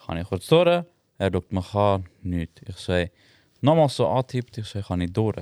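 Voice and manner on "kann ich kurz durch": the same request asked again, clearly higher; insistent repeat. Retold or imitated. Repeating the request to the man blocking the way, higher. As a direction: ask to get past once more, pitched up with some impatience. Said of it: "so gesagt, hey, sorry".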